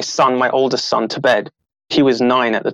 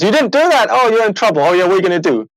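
much higher-pitched mock telling-off; mock scolding; 7 semitones higher, 3 dB louder than usual. Coach scolding a client who didn't do it, mock stern. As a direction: play a coach making empty threats.

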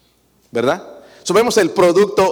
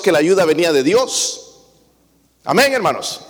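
ordinary talk, nothing marked

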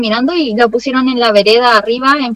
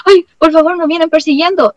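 nervous plea; 6 semitones higher than usual. Retold or imitated. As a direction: burst into the shop begging for help, scared. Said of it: "íbamos súper nerviosas".

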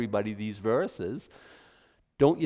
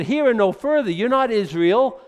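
dismissive temple officials; 5 semitones higher, 8 dB louder than usual. Retold or imitated. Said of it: "they said".

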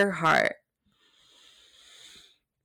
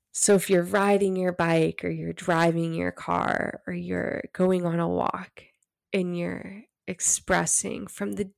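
ordinary delivery

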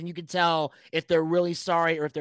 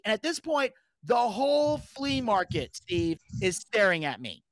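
ordinary talk, nothing marked

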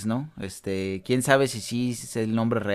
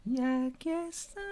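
high squeaky voice; 8 dB quieter than usual. Acted. Mock echo in high voice.

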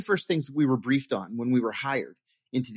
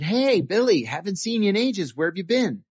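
put-on higher, lively hail to an old acquaintance; hearty greeting; 5 semitones higher, 3 dB louder than usual. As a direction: call out a retold hello in the hall. Retold greeting of old colleague in hall.

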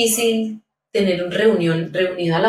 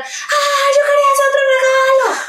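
put-on much higher whiny child voice, fast; imitating crying child; 4 dB louder than usual. Parent imitating her crying child, much higher whiny wail. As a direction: mimic a child wailing over the wrong present. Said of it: "Entonces, se puso a llorar".

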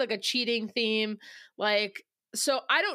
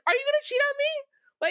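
much higher, put-on voice asking "Are you gonna cheat on me?"; incredulous question. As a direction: play the disbelieving fiancée, pitch way up. Voicing A D's blunt question.

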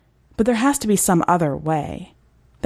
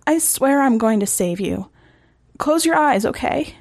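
higher, tender pleading; reassuring promise; 7 semitones higher than usual. Acted. Reagan soothing Eric before the end, tender and higher.

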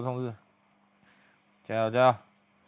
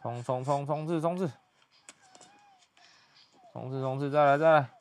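excited cheering; 4 semitones higher than usual. Real, not acted. Cheering on the action.

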